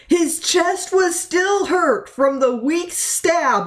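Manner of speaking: high, slow strained cry. Acted. Wounded Mickey telling Mint, high and strained. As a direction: push it up high and slow like it hurts.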